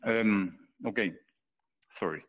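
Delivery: talking through a mouthful; words round a bite of food; mouth full. Real, not acted. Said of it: "I have something in my mouth".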